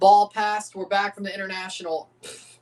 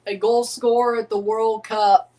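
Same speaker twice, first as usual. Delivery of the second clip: dramatic sports narration; 3 semitones higher than usual. Acted. Streamer narrating Jimenez's comeback to chat, dramatic hype voiceover. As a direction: build his comeback like a documentary trailer.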